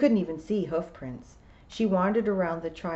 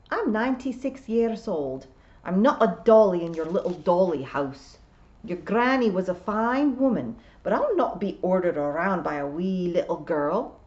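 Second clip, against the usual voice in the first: louder, emphatic Scottish voice; indignant old Scots piper; 5 dB louder than usual. A narrator's reading. Ninety-six-year-old William rebuking a girl, indignant Scots. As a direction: bristle as an old Scot.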